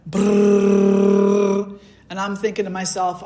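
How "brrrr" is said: a loud rolling brrr for a racing heart; heart pounding noise. Retold or imitated. Telling about her heart racing at work, loud rolling brrr. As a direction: rattle it out like a heart gone wild. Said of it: "and my heart is going".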